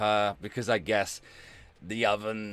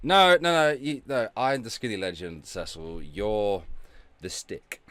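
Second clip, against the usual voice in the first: ordinary delivery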